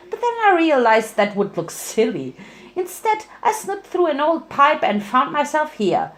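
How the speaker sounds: high, bubbly smiling voice; high chirpy Pinkie voice; smiling Pinky. Acted. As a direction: say it with a grin. Pinkie Pie character voice.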